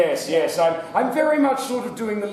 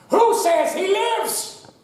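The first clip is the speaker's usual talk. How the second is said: arena emperor; 12 semitones higher, slower than usual. Acted. Host asks the audience to spare the player, in a grand voice. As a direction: call to the mob like a Roman emperor.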